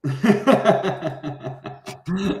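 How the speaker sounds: real high burst of laughter. Real, not acted. Laughing at the joke.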